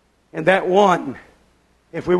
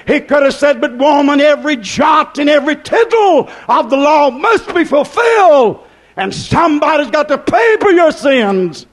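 raised, emphatic preacher's delivery; full-force preaching; 4 semitones higher, 4 dB louder than usual. Acted. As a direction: preach it high, building to shouted peaks.

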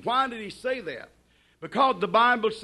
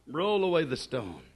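plain low command; 7 semitones lower, 6 dB quieter than usual. Shown as a demonstration. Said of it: "To prove to you that that was her attitude".

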